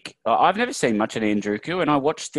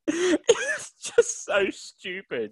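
crying with laughter; high squeaky laugh through words; 13 semitones higher, slower than usual. Real, not acted. Cracking up at the commentary.

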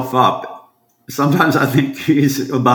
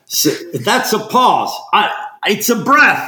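retold exasperated bickering; 8 semitones higher than usual. Retells pause versus breath quarrel.

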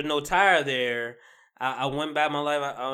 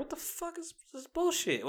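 high, softer, half-laughing disbelief; lightly voiced outrage at the truck; mock disbelief; 13 semitones higher, 12 dB quieter than usual. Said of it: "I'm like".

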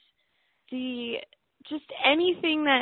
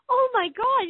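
excited; 12 semitones higher, 3 dB louder than usual. Retold or imitated. Sudden realization, thought quoted.

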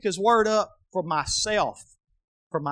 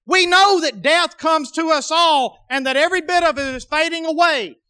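loud, high preaching; 8 semitones higher, 5 dB louder than usual. Acted. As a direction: preach it with full force. Preaching at full force.

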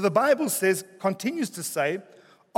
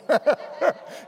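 higher-pitched chuckle at own joke; amused laugh; 7 semitones higher than usual. Real, not acted.

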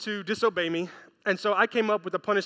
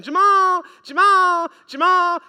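loud, high drawn-out call; imitated mother's high, loud summons; mom calling out; 9 semitones higher, 10 dB louder, slower than usual. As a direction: holler for a child through the house. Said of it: "Jamal's mom was calling his name".

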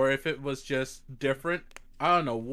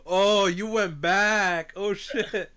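drawn-out, high, loud exclamation; impressed surprise; 8 semitones higher, 5 dB louder, slower than usual. Real, not acted. Friend hears about the old emulator game, impressed surprise. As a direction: react big, stretching the words.

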